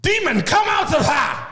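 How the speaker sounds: high, forceful shout as a preacher casting out a demon; shouted mock exorcism; exorcist's command. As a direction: bellow it like an exorcist imitating a revival preacher. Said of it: "he was just like".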